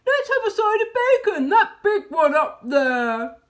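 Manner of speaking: loud, high, laughing badger voice; excited badger. A narrator's reading. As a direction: laugh and cry out in greedy delight. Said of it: "dancing with excitement".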